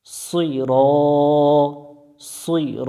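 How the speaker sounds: "সুই র" with the final vowel drawn out; one-alif stretch. Shown as a demonstration. Teacher showing one-alif length, "সুই র" with held ending. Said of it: "এক আলিফ টান দিয়ে পড়ব".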